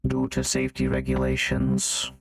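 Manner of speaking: low, slow filtered machine voice; robot voice. Acted. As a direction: announce like a security robot.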